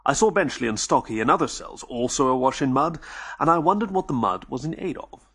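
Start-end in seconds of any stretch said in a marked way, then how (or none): none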